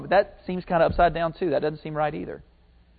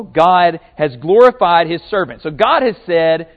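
ordinary talk

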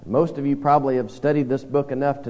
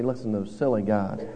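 lower, softer scoffing; nose-in-air sneer; 6 semitones lower, 7 dB quieter than usual. Retold or imitated. Churchgoer turning nose up at sailors, quietly scornful. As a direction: dismiss the gods with a quiet sneer. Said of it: "turn our noses up".

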